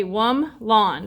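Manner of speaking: "wum lon" in two slow, separate beats; syllable by syllable. Shown as a demonstration. Teacher showing a word has two syllables, syllable by syllable. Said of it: "That sounds like two syllables".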